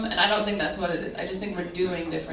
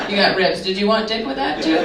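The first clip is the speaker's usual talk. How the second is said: louder, laughing mock host; mock raffle host; 2 semitones higher, 7 dB louder than usual. Imagined raffle man offering meat with a smutty joke. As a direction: hand out the meat like a cheeky host.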